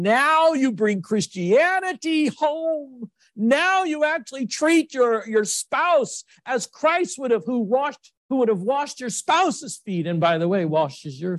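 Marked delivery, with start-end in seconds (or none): 0.0-10.1 high, slow, rising preacher's cry; impassioned sermon climax; fervent preaching. Acted. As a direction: lift the congregation with fervour. Preacher builds to a peak.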